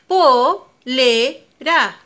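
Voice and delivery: "Polera" said very slowly, syllable by syllable; syllables apart. Shown as a demonstration. Teacher to child, splitting polera into syllables. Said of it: "Separemos polera".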